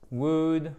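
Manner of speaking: "Would" drawn out with no L; silent L. Shown as a demonstration. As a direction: say "Would" to rhyme with good. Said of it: "On ne prononce pas le L". (« Would » prononcé sans le L)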